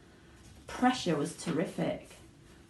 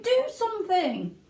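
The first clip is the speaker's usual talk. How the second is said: screaming mother; 12 semitones higher, 4 dB louder than usual. A narrator's reading. Mother screaming at her husband, high and loud. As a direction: shriek at your husband. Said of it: "screamed at Mister Gloop".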